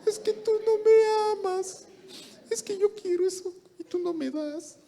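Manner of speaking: put-on a spoiled girl sulking at her boyfriend; whiny girl. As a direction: whine as if wronged. Girl whines to partner.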